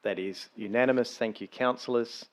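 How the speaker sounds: usual speaking voice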